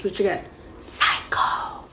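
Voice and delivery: slow hushed aside; whispered aside. Real, not acted. Reviewer calling Snow a psycho in a whispered aside. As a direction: drop to a whisper for the jab.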